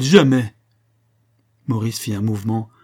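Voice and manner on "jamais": loud desperate cry; a loud, falling cry of refusal; wife crying out loud to stop the duel; crying out again. A narrator's reading. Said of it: "s'écria-t-elle".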